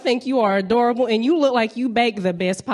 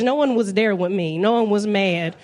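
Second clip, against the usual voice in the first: usual speaking voice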